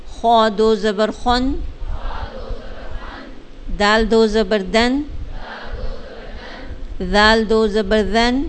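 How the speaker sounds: put-on sing-song spelling for children; slow recitation drill. Shown as a demonstration. Teacher chanting the alphabet drill to children, slow sing-song. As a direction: chant each letter slowly for the class. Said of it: "میں بچوں کی طرح یہ آپ کو پڑھانے لگ گئی ہوں".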